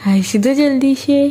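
put-on high, sing-song cooing at a baby; baby talk. Mother coaxes her serious baby daughter with high cooing. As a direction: coo to the little one to get a smile.

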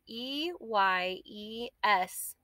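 slow, letter by letter; spelled out letter by letter. Shown as a demonstration. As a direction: spell each letter out slowly. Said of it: "spelling the word aloud".

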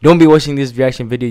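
fast and loud, pushing the energy up; urging viewers not to be bored, hyped. Real, not acted. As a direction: rev up the viewers. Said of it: "hype it up".

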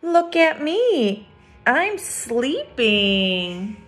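lifted, sing-song "Look at me"; picture book read-aloud; storybook refrain. A narrator's reading. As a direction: read the refrain brightly for kids.

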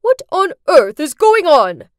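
loud outraged bellow; furious yell. Acted. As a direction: bellow it at the whole church. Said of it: "yelled in front of everyone".

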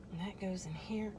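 soft unvoiced whisper; whisper to self. Real, not acted. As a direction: whisper it quietly while fiddling. Muttering while assembling.

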